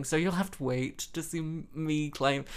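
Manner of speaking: normal talking voice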